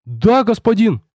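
put-on high-pitched boy's eager reply; boy snapping to. A narrator's reading. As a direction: answer the master high and startled. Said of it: "опомнился парнишка".

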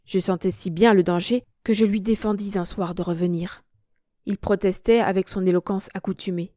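usual speaking voice

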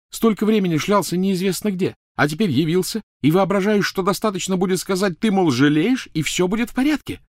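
high, scathing rant; loud, high-pitched outrage; indignant tirade. Acted. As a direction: berate him without letting up. Hermione's tirade at Ron.

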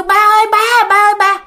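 aunt calling her dead father from the balcony, high loud call. Retold or imitated. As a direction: call out to a father far down in the street. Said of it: "Cái mợ mới kêu".

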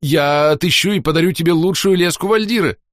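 grovel in horror; terrified fawning. A narrator's reading. Groveling continues.

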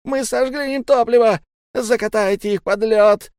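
very high, loud frantic cry; hysterical. A narrator's reading. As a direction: shriek the news from below. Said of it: "истеричный голос Зиана".

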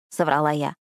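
usual speaking voice

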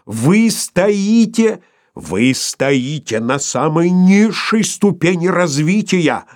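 high, loud, very slow bellow; outshouting. A narrator's reading. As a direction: roar over everyone, word by word. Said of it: "перекричал Филипп Филиппович".

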